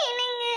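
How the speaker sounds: high tearful sob. Acted. Wife sobbing as her husband arrives. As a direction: break into a sob then call him.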